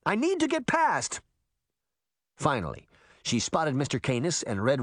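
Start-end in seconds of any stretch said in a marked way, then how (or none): 0.0-1.2 put-on girl shouting to get past. A narrator's reading. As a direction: yell to push through the crowd. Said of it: "she shouted".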